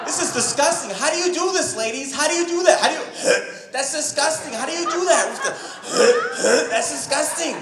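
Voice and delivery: high, laughing and grossed out; laughing disgust. Retold or imitated. Comic grossed out by the camera, high laughing squeal. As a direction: squeal through giggles at the gross thought.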